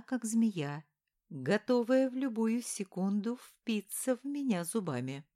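plain reading voice

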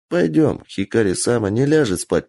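ordinary delivery, nothing marked

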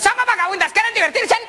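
high squawky parrot voice; shrill little bird calling out; cheeky parrot. Acted. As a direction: chirp it like a saucy parrot. Parrots shout at the priest.

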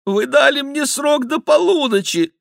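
high, imploring reminder; pleading. A narrator's reading. The king imploring the plotters for his promised time, high and pleading. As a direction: beg them to keep their word. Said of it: "умоляюще сказал король".

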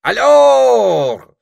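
high, loud wake-up call; крик. A narrator's reading. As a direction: shout slowly to rouse a sleeper. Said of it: "закричал он". (громкий протяжный крик)

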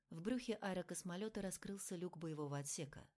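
ordinary delivery

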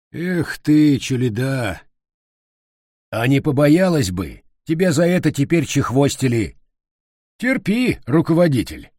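loud, mocking and bitter; bitter irony. A narrator's reading. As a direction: sneer it with spiteful irony. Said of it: "со злой иронией".

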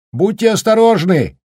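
высокий громкий прощальный крик; прокричать вслед уезжающему; крик на прощание. Acted. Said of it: "крикнул на прощание Даркин". (прощальный крик вслед)

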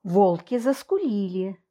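ordinary delivery, nothing marked

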